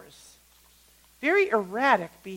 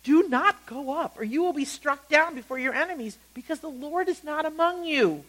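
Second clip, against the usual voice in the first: acted prophet's stern warning, pitched up; Moses warning; 6 semitones higher than usual. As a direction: warn them firmly, each word deliberate at first. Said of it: "Moses says".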